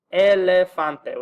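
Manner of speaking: elefante slow and loud, e-le-FAN-te; Spanish elefante. Shown as a demonstration. Teacher slowly models a Spanish E word. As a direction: stretch out elefante clearly. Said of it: "which means elephant".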